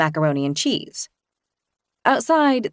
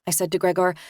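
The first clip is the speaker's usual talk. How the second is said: ordinary delivery, nothing marked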